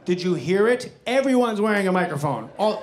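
ordinary talk

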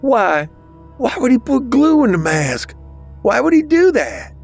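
very high, frantic questioning; freaking out. A narrator's reading. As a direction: demand answers in a high panic. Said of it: "Bobby was freaking out".

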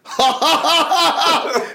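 big put-on cackling laugh; imitated guffaw. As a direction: imitate a mate bursting out laughing. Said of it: "he went like this, he went like".

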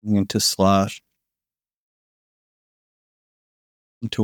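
sleep story narrator. Acted. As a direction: lull them toward sleep, breath by breath. Guided body-melting sleep relaxation.

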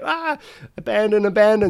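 high-pitched cry of mock alarm; mock panic. Driver retelling his crew scattering as police arrive, in mock panic. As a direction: yelp it like calling a hasty retreat.

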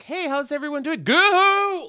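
high, bright emcee voice; hammed-up nervous host; mock stand-up greeting. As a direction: overdo the crowd greeting. Mock comic greeting the crowd.

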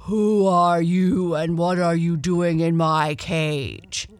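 loud, booming bear demanding an answer; forceful storybook bear; big bear. A narrator's reading. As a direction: challenge the intruder as a big bear. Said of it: "the bear asked".

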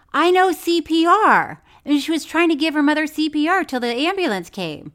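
high, loud, exasperated yell. Real, not acted. Co-host arguing back about the CPR, yelling. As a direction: raise your voice, high and forceful. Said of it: "Don't you yell at me".

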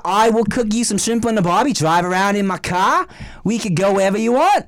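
broad put-on Aussie, r's dropped; mock Australian accent. Promoter offering hospitality in a hammed-up Australian accent. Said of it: "That's a weird accent you're doing".

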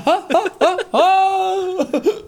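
high gull cackle, drawn out; screechy seagull voice. Retold or imitated. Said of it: "They're just laughing".

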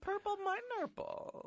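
high, slow goofy squeak; silly high voice. Pretend. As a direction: squeak it out like a cartoon. Silly made-up voice.

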